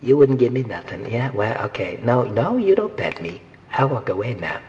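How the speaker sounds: mock pet begging for scraps, fast; voicing a pet pleading for food; dog voice commentary. Imitating a begging pet at the dinner table. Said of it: "and he'd be saying, like".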